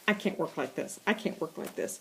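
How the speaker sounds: fed-up mouse, voice sinking to a whisper; muttering mouse. Acted. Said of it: "Mouse says".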